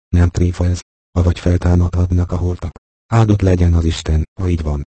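ordinary delivery

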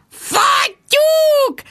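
very high shrieked curse; drunken shrieked Fattyúk; shrieking. Acted. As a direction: shriek it at the top of your voice. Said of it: "rikoltotta Jude".

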